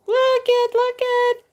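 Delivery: high, loud, thrilled look it; excited squeal. Real, not acted. Host squealing as she shows viewers her tumbler. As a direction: squeal it out to show off the gift.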